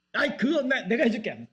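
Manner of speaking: high, laughing, proud offer; eager husband. Pretend. Husband eagerly taking over the heavy lifting, laughing. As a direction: jump in like a pleased husband.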